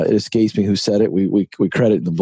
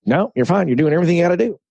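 Don's Texas voice; 8 semitones higher, much faster than usual. Retold or imitated. Mentor on the phone reassuring Ken, high Texas voice. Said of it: "in his high-pitched Texas voice".

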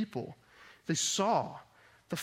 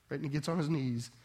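ordinary delivery

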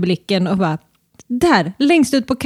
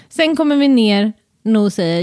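normal talking voice

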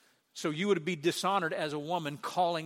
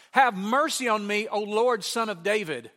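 loud, raised plea for mercy; crying out; 5 semitones higher, 9 dB louder than usual. Retold or imitated. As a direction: call out to the Lord as a begging woman. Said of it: "she's crying".

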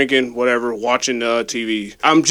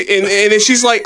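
ordinary delivery

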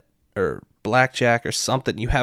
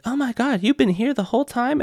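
surprised cheerleader voice; 13 semitones higher than usual. Retold or imitated. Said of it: "was like".

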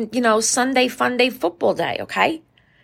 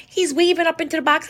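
ordinary talk

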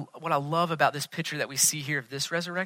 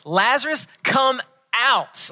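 raised, higher commanding call; loud cry; 6 semitones higher, 4 dB louder, slower than usual. Retold or imitated. Preacher voices Jesus calling a dead man, loud cry. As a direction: call it out loudly, pitched up. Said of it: "cries out with a loud voice".

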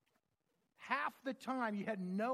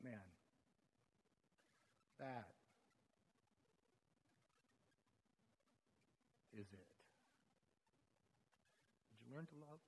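soft, lower, with long pauses; hushed reflection; 6 semitones lower, 20 dB quieter than usual. Real, not acted. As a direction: let it sink in, barely above a breath.

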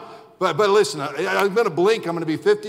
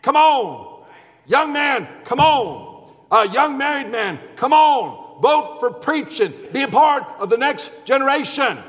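calling out; 4 semitones higher than usual. Retold or imitated. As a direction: echo the preacher pleading come on. Retold preacher calling people forward.

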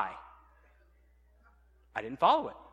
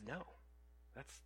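whispered, much softer, a gentle no; God's hushed reply; 4 semitones lower, 24 dB quieter than usual. Acted. God whispering a correction to the excuse-maker, hushed. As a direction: breathe it like a quiet correction from above. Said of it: "God says".

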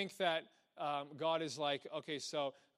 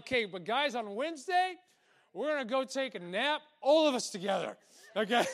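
mock lazy commander; 7 semitones higher, 5 dB louder than usual. Mock soldier announcement.

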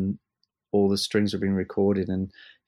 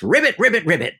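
mock frog croak; 8 semitones higher, 5 dB louder, faster than usual. Imitating frog croak in quote.

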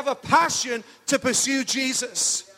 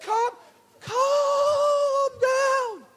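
much higher, laughing falsetto "calm down"; Michael Winner impression; 13 semitones higher, much slower than usual. [name] teasing Phil in a squeaky mock Michael Winner voice. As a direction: squeal it out, cracking up. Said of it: "the Michael Winner advert".

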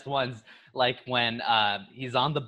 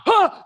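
high, loud karate cry; imitated martial-arts punch shout; mock fight yell; 11 dB louder than usual. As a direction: let out a sudden hammed-up "hah!". Imitating punches in film scene.

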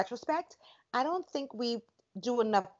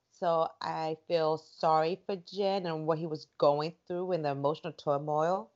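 usual speaking voice

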